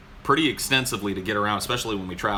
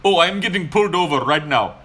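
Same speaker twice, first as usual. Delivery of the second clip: high, louder excuse in a mock Indian accent; 7 semitones higher, 7 dB louder than usual. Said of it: "And he goes".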